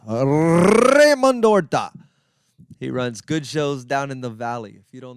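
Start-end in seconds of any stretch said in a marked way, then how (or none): 0.1-1.9 hammed-up Spanish accent, higher, louder and drawn out very slow; horrible Mexican accent. Shown as a demonstration. Hyping up his friend Raymond Horta in an over-the-top Mexican accent. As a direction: roll out the name like a booming Mexican announcer. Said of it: "I do a horrible Mexican accent".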